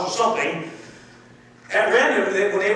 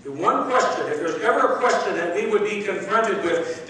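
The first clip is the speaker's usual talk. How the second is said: ordinary talk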